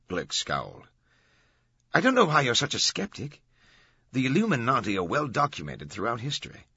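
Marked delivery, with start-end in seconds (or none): 1.9-6.6 lightly voiced reporter, higher in pitch; defensive reporter. A narrator's reading. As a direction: defend your story to a skeptic.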